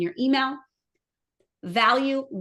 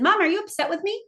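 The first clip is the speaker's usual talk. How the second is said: higher, a light voicing of a teenage daughter asking if mom is upset; worried daughter; 9 semitones higher, faster than usual. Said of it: "daughter called me and she said".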